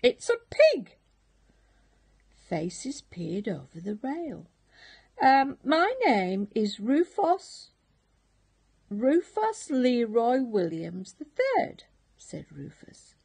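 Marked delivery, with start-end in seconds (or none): 0.0-1.0 very high, loud astonished cry; a hammed-up pirate's shocked yelp; shocked squeal. First mate cries out. 5.2-11.8 a lightly voiced polite young pig; a hint of nervous piglet pride; eager little pig. Rufus introduces himself again.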